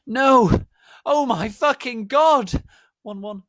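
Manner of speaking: high, loud frightened shout; terrified cry; scared shout. Acted. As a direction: shout in terror. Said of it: "I shouted in a scared voice".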